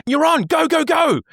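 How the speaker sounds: very high, frantic urgent cue; pranking the tenor with a high, frantic false cue; mock panic. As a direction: fake a panicked stage manager. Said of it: "I'd go".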